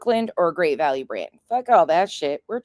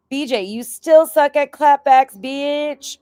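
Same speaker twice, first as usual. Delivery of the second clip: high, loud jeering read of a chat message; loud teasing shout; loud mock taunt; 10 semitones higher, 7 dB louder, slower than usual. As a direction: bark it out like a heckler. Reading out a chat insult.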